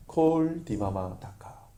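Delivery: 콜 디마마 다카 said slowly, syllable by syllable; Hebrew phrase. Shown as a demonstration. Pastor teaching the Hebrew words, slow and careful. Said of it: "히브리어로 이런 말이에요".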